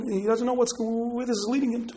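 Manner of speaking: ordinary delivery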